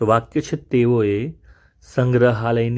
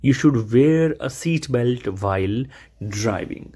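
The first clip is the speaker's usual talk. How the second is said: slow teaching read; much slower than usual. Shown as a demonstration. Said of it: "teacher models the sentence".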